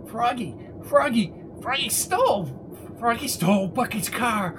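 high, soft, eerie singsong; crazed informant. Acted. Prisoner blurting a secret from the cell, crazed high voice. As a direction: squeak it out in a small falsetto.

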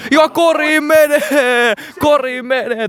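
high, slow triumphant call; basket celebration. Pretend. Commentator hails the basket, high and triumphant.

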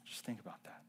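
hushed whisper; soft whispered challenge. Acted. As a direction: whisper it to the church. Whispered aside in sermon.

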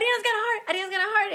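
high, loud joyful shout; excited shouting. Retold or imitated. Mother waking the family, shouting the news high and loud. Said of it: "knocking on doors, and I'm like".

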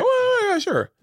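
high, put-on overly welcoming voice; mock eager host. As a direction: caricature your too-friendly self. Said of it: "I'm just so welcoming".

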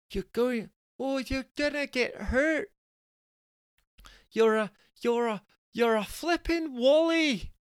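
very high worried wife's voice; slow, squeaky, worried insult; high slow fretful name-calling; fretting wife. Acted.

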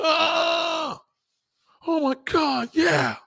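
hammed-up pleasure cry; mock ecstatic cry. Woman climaxing from dirty talk, hammed-up ecstatic cry. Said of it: "they gonna be like".

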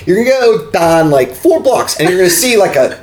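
a hint of Pittsburgh yinzer giving directions; mild Pittsburgh accent pointing a stranger past the Quiznos. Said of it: "That's the Pittsburgh accent".

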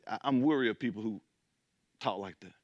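ordinary delivery

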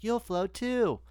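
high, eerie clown taunt, sung out; creepy clown voice. Retold or imitated. Friends recalling a horror movie, high eerie clown voice. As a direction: mimic the scary clown luring a kid. Quoting Pennywise line.

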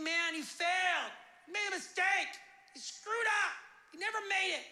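a mock crowd calling Moses a failure; imitating critics. Voicing people who judge Moses.

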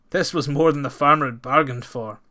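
ordinary delivery, nothing marked

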